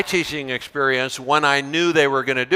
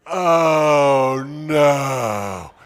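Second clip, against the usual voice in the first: a drawn-out, lightly wailed groan; mock groan. Teacher acting a kid groaning at a handout, mild mock groan. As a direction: imitate a student dreading the handout. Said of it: "and the kid goes".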